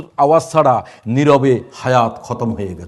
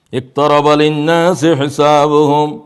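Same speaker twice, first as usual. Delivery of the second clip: slow melodic chanting of the verse; Quran recitation; 3 semitones higher, 4 dB louder, much slower than usual. Acted. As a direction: draw out each word reverently. (ধীর সুরে কুরআন তিলাওয়াত)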